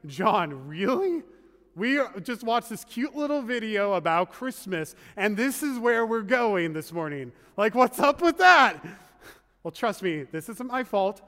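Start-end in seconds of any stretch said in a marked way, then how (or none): 0.1-8.9 mock-incredulous questioning, rising to a loud finish; incredulous objection. Congregant questioning the pastor about this morning's story, incredulous. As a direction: protest like a baffled listener, building in volume. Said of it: "you may be asking".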